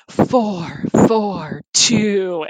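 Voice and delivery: slow sultry ad read; breathy seductive radio voice; sexy voice. Retold or imitated. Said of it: "do the four four two ads in a sexy voice".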